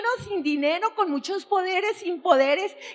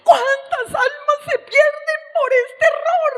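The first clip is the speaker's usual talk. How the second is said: crying out, raised high; tearful preaching; 6 semitones higher than usual. Real, not acted. Preacher weeping over souls lost, crying out. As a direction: lament through tears, pleading.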